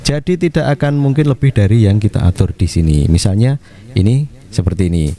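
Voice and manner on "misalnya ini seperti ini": ordinary delivery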